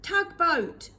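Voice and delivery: loud high shout. A narrator's reading. Fingerbone on the sandhill shouting across the storm, loud. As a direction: call it out over the gale. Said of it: "he shouted".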